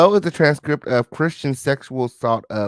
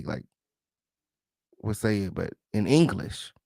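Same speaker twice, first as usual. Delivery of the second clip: ordinary talk, nothing marked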